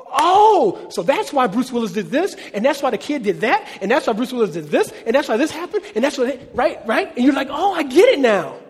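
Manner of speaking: high, loud rush of sudden understanding; excited realization. Retold or imitated. Preacher voicing a viewer at the end of Sixth Sense, excited realization. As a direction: let it all click, piling up each why.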